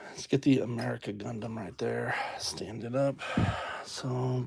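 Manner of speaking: soft whisper to self. Real, not acted. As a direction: whisper it under your breath. Muttering to self while building.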